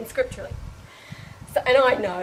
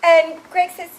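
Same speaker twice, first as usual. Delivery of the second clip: ordinary talk, nothing marked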